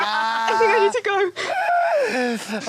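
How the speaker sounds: high, rattled pleading; spooked by spiders, begging to leave; frightened plea to Joel to go; panicked. Real, not acted. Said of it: "I'm like Ron Weasley".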